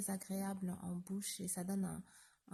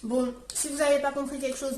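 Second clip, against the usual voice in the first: talking through a mouthful of food; mouth full; 5 semitones higher, 11 dB louder, faster than usual. Real, not acted. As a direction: speak round a bite you're still chewing. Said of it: "je parle la bouche pleine".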